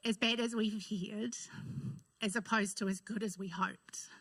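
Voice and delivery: holding back sobs, barely audible; crying. Real, not acted. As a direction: voice breaking into a whisper. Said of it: "here goes the waterworks already".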